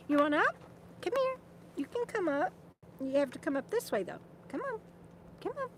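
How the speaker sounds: high, sing-song pet talk; coaxing the dog. Real, not acted. Calling her dog up onto her lap, high and coaxing. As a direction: coax the dog up sweetly.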